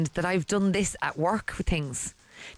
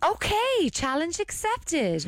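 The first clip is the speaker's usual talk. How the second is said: high, slowing mock-posh accent; posh attempt, oddly American; 9 semitones higher, slower than usual. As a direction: put on a bad posh accent. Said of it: "in her posh voice".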